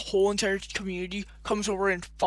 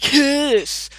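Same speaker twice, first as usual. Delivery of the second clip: mock yell, much higher and much louder; mock kid yell; 6 semitones higher, 10 dB louder than usual. Vlogger mocking a young fan who yells at him, hammed-up shout. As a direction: blurt it like a shrieking five-year-old fan. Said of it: "and be like".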